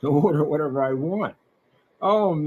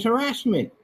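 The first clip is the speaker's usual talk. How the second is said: mock scream of harassment; 8 semitones higher than usual. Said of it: "He screams".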